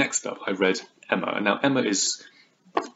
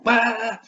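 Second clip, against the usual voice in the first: high, short "ah" outburst; mock cry; 3 dB louder than usual. Reader showing what's really going on, high mock cry. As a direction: let out a high comic cry. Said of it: "what's going on is like".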